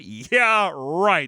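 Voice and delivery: high, drawn scoff; sarcastic dismissal. Real, not acted. Guest scoffing at three hours of walking, sarcastic. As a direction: shoot the idea down with heavy sarcasm.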